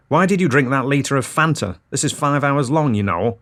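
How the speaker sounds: loud scolding. Acted. Wife scolding husband in the silent hall, loud exasperation. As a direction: scold him out loud, forgetting the hush. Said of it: "quite loudly".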